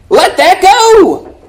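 preacher easing the congregation, slow and high; slow, drawn-out release. Acted. Urging the flock to release grudges.

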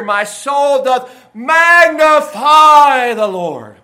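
booming, long-held "magnify"; swelling crescendo. Shown as a demonstration. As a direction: proclaim it like a megaphone, stretched out very slowly. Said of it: "that's the idea here".

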